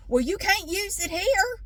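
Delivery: high-pitched imitated scolding; mock officious telling-off; yelling. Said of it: "Why is he yelling at me".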